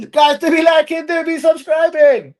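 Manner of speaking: high, loud sing-out to viewers; hammed-up subscribe plug.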